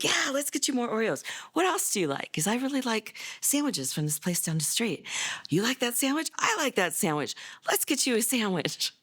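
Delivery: put-on high, bright and coaxing, like bribing a teen with food; a hammed-up, cheery doctor winning him over; imitating the eager doctor.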